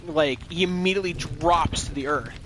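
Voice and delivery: ordinary talk, nothing marked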